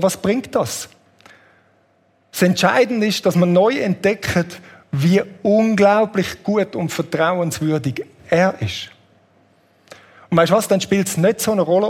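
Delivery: ordinary talk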